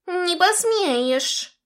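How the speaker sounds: outraged cat voice; hammed-up indignant cat. A narrator's reading. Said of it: "возмутился дух".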